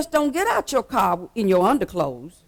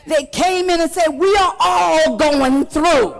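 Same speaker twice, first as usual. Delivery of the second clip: raised, impassioned church preaching; full-force preaching; 5 semitones higher, 5 dB louder than usual. Acted. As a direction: drive each phrase home to the congregation.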